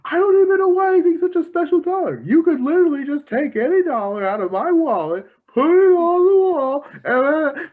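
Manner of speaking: high, fast, loud mocking voice; a put-out fussy character in full swing; imitating Squidward. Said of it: "And he's like".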